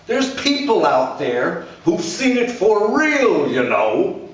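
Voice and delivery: a blustering father scolding his son; angry father. A narrator's reading. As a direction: lecture him like a bitter dad.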